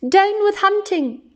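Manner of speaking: high, hooted protest chant; high-pitched wail of a slogan; hooting. A narrator's reading. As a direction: hoot it like a young dinosaur. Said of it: "And he hooted".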